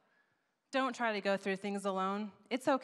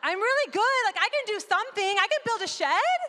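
higher and louder, bragging fast; eager self-boast; 13 semitones higher, 8 dB louder than usual. Retold or imitated. Preacher mimicking her own eager boast, higher and louder. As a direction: sell yourself like a proud handyman.

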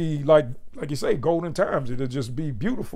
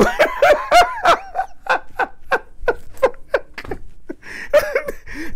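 wheezing high-pitched laughter; long real laughing fit. Real, not acted. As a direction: lose it completely. Cracks up at the line.

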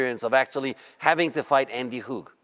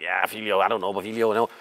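dismissive; 5 semitones lower than usual. Retold or imitated. Brushing off the hype about rival.

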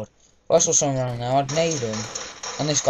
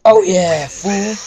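loud gloating whoop over the kill; triumphant taunt after getting the kill; gloating cheer; 5 semitones higher, 6 dB louder, much slower than usual. Retold or imitated. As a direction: crow at the beaten player.

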